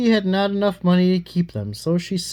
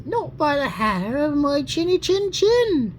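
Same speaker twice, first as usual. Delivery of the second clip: squeaky little cat; 8 semitones higher than usual. Acted. Little cat answers the wolf.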